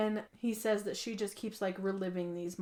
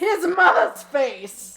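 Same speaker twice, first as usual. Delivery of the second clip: loud, slow, higher-pitched exclamation; dramatic reveal; 7 semitones higher, 15 dB louder, much slower than usual. Real, not acted. Host confirms shocking reveal to co-host, dramatic. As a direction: draw out the big reveal loudly.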